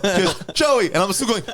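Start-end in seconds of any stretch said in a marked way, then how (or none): 0.5-0.9 high, mock girlfriend voice; higher pitched call of Joey; girlfriend calling his name.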